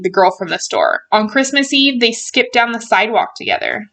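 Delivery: ordinary delivery, nothing marked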